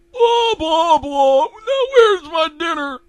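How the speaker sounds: loud, very high mocking old crank; mock her, then demand loudly in a high voice; Eustace grumbling. Eustace demanding his dinner from his wife, loud and mocking.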